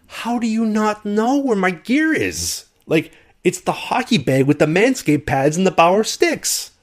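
high, slow incredulous rant; acted exasperation. Player to airline about lost hockey gear, high exasperated outburst. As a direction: spell it out in strained disbelief.

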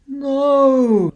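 high loud cry of dismay; alarmed cry. A narrator's reading. Pig finds the fire out with the wolf coming, high alarmed cry.

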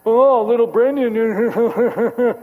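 slow, drawn-out teasing; mock the kid in front of the class; sneering teacher teasing a pupil. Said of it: "makes fun of me in front of everybody".

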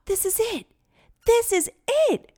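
thrilled, rising to a shout; excited discovery. A narrator's reading.

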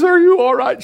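high, loud, shaken mock cry; catching her breath. Wife to husband after the raft fell, breathlessly alarmed. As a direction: imitate a woman panting after a scare. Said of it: "she's catching her breath".

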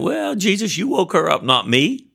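high-pitched, comic protest to Jesus; mock complaint. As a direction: whine it high, poking fun at yourself. Jokingly retells his reluctant prayer.

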